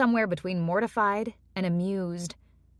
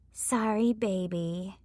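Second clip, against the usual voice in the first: sexy voice; 4 dB quieter, slower than usual. Acted. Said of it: "her version of a sexy voice".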